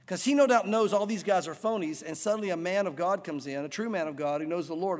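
ordinary talk

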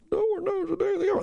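high, far-off echo of the listeners; mock crowd repeating back. Shown as a demonstration. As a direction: voice the audience answering back. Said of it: "everybody repeat after me".

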